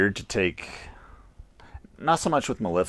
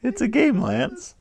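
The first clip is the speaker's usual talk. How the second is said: laughing reassurance; 13 semitones higher than usual. Real, not acted. Reassuring scared friend while laughing.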